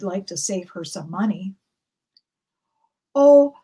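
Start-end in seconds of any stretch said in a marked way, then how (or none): 3.1-3.6 surprised oh. Acted. Old woman exclaims.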